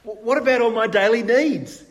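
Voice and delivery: a disciple anxiously asking Jesus about his daily needs. Acted. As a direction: fret over your daily needs out loud. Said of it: "is what a disciple is asking".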